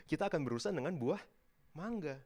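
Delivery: usual speaking voice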